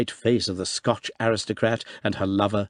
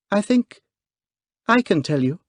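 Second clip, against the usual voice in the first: clear, quivering voice; 11 semitones higher, 4 dB louder than usual. A narrator's reading. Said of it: "in that clear, quivering voice".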